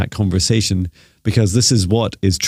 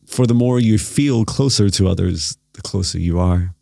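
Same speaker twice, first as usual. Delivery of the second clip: guided meditation. Acted. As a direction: gently lead them into stillness.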